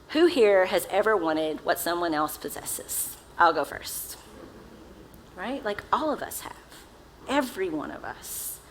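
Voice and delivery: ordinary delivery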